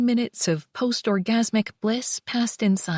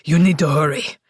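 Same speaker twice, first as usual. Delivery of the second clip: low urgent shout; male shout; 7 semitones lower, 4 dB louder than usual. Acted. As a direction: bark it out, low. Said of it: "as he shouted".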